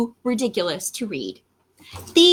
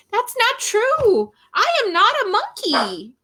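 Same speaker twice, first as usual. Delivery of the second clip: whiny protest; 9 semitones higher than usual. Acted. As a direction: protest as if falsely accused. Reader protests against the book.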